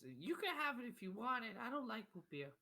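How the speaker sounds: very high, put-on little kid voice; high-pitched child's grumble; whiny child. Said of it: "He goes".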